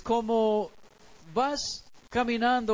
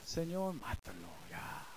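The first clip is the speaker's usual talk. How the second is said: much lower, much softer aside under the breath; muttered prayer; 9 semitones lower, 13 dB quieter than usual. Retold or imitated. Pastor jokingly prays under his breath about the rude neighbor, low and soft. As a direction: mutter it to God as a joke.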